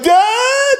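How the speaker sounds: high yelled cry for dad; desperate shout to his dad; calling out. Retold or imitated. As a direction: call for help like a frantic kid. Child calling dad for help.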